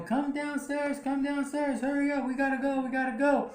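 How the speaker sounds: high, urgent mock calling; high-pitched calling without real volume; retold yelling. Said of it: "so she's yelling at Michael".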